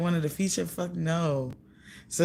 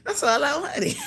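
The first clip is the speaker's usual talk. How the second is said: high, laughing triumph; giggling gloat; 5 semitones higher than usual. Real, not acted. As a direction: crack up in victory. Gloating laugh over his saltiness.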